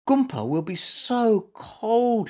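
high, slow fretting; worried. A narrator's reading. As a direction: fret for an absent friend. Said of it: "he worried".

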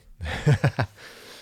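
a short choked-up laugh; a real laugh breaking through emotion; a short real laugh while moved retelling the birth; brief emotional laugh. Real, not acted. Emotional laugh mid-story.

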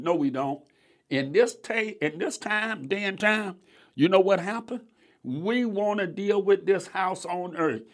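normal talking voice

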